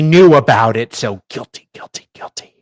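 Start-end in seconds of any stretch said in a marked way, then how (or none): 1.3-2.5 mock whisper.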